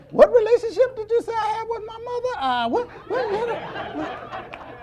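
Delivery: can't believe what he just heard; mock outraged disbelief. Churchgoer asks the usher to repeat the insult, high outraged disbelief.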